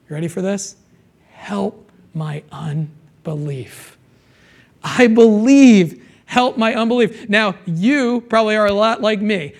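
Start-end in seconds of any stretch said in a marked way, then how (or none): 1.3-4.0 slow, soft, hushed cry for help; a hushed broken plea; quiet plea. Retold or imitated. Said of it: "The father cried out". 4.8-7.3 a loud pleading cry; cried out. Retold or imitated. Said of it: "The father cried out".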